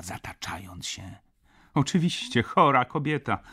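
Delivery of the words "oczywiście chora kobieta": plain reading voice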